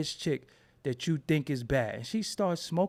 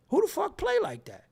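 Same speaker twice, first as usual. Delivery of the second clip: incredulous; 12 semitones higher than usual. Retold or imitated. Disgusted at men horseplaying.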